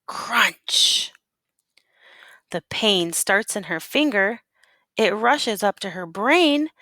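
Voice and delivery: story narration. Acted. Teacher reads picture book aloud.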